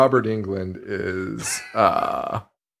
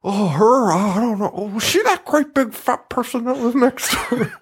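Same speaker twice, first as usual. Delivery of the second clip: hammed-up oddball feigning ignorance, climbing higher; Buffalo Bill; 11 semitones higher than usual. Quoting a favourite character for laughs, high hammy impression. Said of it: "It's the same as Buffalo Bill".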